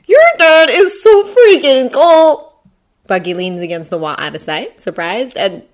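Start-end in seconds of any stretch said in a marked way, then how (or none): 0.1-2.5 high, loud, stretched-out gushing; loud, drawn-out fanboy awe; awestruck. A narrator's reading. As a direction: gush like an amazed boy.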